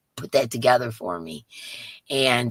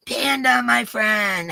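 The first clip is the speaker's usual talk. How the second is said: higher and louder hello; happy greeting; 8 semitones higher, 5 dB louder, slower than usual. Real, not acted. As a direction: warm shout-out. Greeting a viewer.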